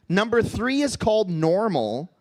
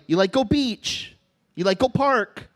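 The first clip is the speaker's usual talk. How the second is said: Pidgin to his dog; 4 semitones higher than usual. Retold or imitated. Comic voicing himself talking Pidgin to his pit bull. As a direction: sweet-talk the pit bull in light Pidgin. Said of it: "one time I will talk Pidgin".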